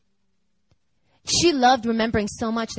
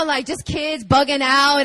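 ordinary talk, nothing marked

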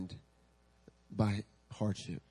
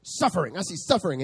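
higher, grave prophetic declaration; prophetic pronouncement; 6 semitones higher, 5 dB louder than usual. Retold or imitated. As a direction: announce it like a solemn vision. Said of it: "And he's like".

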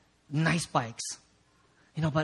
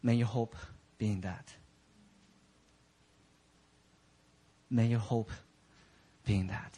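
low, hushed and reverent; solemn appeal; 10 semitones lower, 6 dB quieter than usual. Real, not acted. Preacher gently offers hope to the congregation, hushed and solemn. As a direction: speak softly and low, letting it settle.

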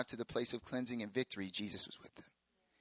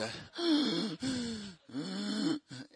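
gasp for breath; 9 semitones higher than usual. Retold or imitated. Couldn't breathe from heartburn.

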